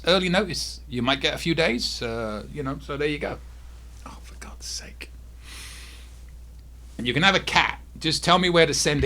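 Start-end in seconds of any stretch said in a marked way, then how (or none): 4.0-5.1 whispered exasperation at the cat; fed-up whisper aside; exasperated whisper. Real, not acted. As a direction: breathe it out in frustration.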